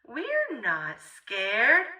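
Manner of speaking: usual speaking voice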